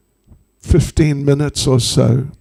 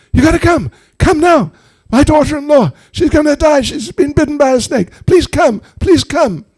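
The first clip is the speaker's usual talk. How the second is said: high, urgent pleading; Jatila's frantic plea; 12 semitones higher, 4 dB louder, faster than usual. Acted. Mother-in-law begging a snake doctor to come, frantic. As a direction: beg for help in a high, insistent voice.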